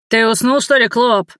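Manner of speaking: rough guard barking an order. A narrator's reading. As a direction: snap at the slave like a brute. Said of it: "грубо рявкнул".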